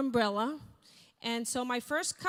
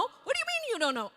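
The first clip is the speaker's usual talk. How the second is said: much higher, fast and exasperated; escalating husband; 10 semitones higher, faster than usual. Retold or imitated. Husband demanding answers from his wife, even more exasperated. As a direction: push the question harder, losing patience.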